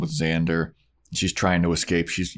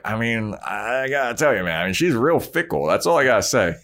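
smug Angel; 4 semitones higher than usual. Retold or imitated. Angel voiced mocking Spike.

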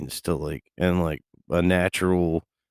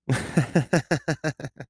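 high laugh; 9 semitones higher than usual. Real, not acted. After calling out to buddy.

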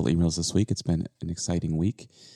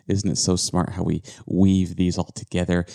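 usual speaking voice

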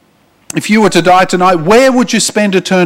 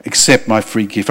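tender call of the Lord; 8 semitones lower, 3 dB quieter than usual. Acted. Preacher voicing the Lord inviting listeners, softly tender. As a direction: lower and softer, gently inviting. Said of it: "the Lord is reaching out to you today and saying".

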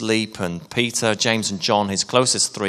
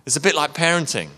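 ordinary talk

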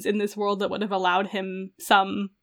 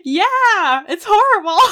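shrieking with laughter over the plot; a loud pained laugh, high and bright; horrified laughing outburst; laughing shout; 11 semitones higher, 6 dB louder, slower than usual. Real, not acted. Reacting to a painful plot twist.

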